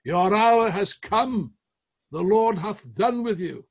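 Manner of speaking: slow, booming, high and loud proclamation; voice like thunder. Retold or imitated. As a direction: thunder it out like divine judgment. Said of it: "a voice like thunder echoes across the House".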